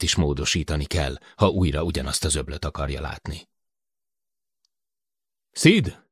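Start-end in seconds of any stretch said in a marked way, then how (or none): 5.5-6.0 loud high call across the room; loud excited call. A narrator's reading. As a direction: shout his name to get him over. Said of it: "kiáltott".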